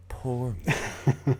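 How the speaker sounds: ordinary talk, nothing marked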